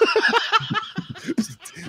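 high burst of loud laughter. Real, not acted. Rapper cracking up at the end, loud laughing. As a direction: crack up loudly.

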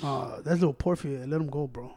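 a hint of a big brother calling it off; older brother. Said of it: "he went like this".

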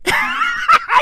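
big high shrieking laugh; cackling laugh at his own bit. Real, not acted. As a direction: burst out laughing at yourself.

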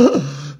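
sharp acted intake of breath; mock gasp. Courtroom shocked by the tie reveal, imitated gasp. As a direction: gasp like a stunned crowd. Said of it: "the courtroom goes".

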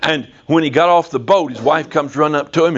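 ordinary talk, nothing marked

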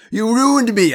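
loud, high wail of accusation; faked yell. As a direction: yell it out in put-on rage. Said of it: "I yelled".

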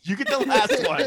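laughing. Real, not acted. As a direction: repeat it through a laugh. Laughing echo of claim.

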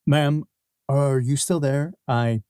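ordinary talk, nothing marked

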